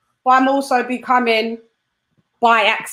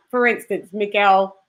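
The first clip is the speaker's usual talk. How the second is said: ordinary talk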